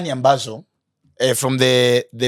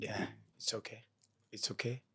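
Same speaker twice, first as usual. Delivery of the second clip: soft reassurance; 7 dB quieter than usual. Real, not acted. Consoling a crying guest.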